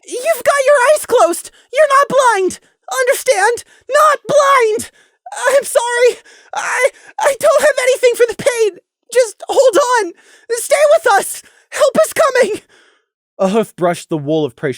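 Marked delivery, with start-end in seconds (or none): 0.0-4.9 very high, loud, straining shout; very high, desperate yell; forced shout. A narrator's reading. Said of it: "Prey made himself shout again". 5.4-12.6 very high, loud, tearful yell; very high, crying, pleading shout. A narrator's reading. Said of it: "Prey made himself shout again".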